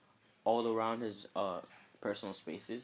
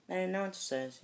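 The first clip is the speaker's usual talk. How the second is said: plain reading voice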